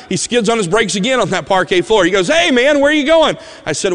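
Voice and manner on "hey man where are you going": put-on much higher and louder, eager boy calling out; excited shout. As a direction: burst in like a thrilled kid skidding to a stop.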